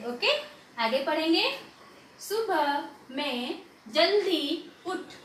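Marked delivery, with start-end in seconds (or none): 2.2-5.1 very slow, word-by-word sentence reading. Shown as a demonstration. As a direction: separate each word and hold its vowel. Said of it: "पहले धीरे धीरे पढ़ना सीखो". (धीरे-धीरे, शब्द अलग करके पढ़ना)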